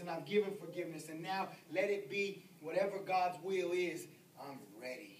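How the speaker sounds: hushed words of a dying man at peace; quiet prayer. Retold or imitated. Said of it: "lift your head up and say".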